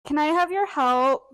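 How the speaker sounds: calling loudly across the room. Real, not acted. Calling partner for jar help.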